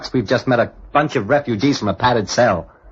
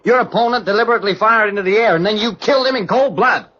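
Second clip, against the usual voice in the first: angry accusation; 8 semitones higher than usual. Acted. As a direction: hurl the charge at him. Accuses Excellency of murder.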